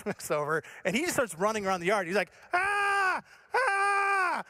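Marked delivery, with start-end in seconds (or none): 2.5-4.4 high, sustained mock scream; hammed-up "aaah" of a screaming boy. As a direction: imitate a panicked kid screaming on one long high note. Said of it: "other than yell and scream".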